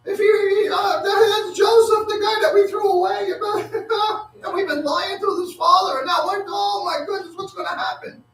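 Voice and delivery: very high, shrieking mock panic; hammed-up panic. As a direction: squeal like terrified brothers caught out. Brothers voiced panicking at Joseph's reveal.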